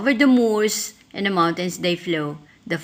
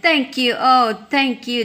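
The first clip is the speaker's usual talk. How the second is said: louder, higher thanks; grateful cry; 5 semitones higher, 4 dB louder than usual. A narrator's reading. Grateful witch thanks her friends, relieved and loud. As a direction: gush with relief. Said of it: "the grateful witch cried".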